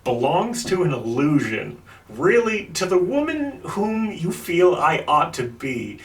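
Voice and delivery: high, slow, theatrical recital; dramatic letter reading. Retold or imitated. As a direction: read it like a heartfelt letter.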